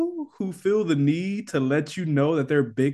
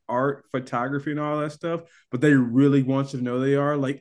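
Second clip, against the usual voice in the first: ordinary delivery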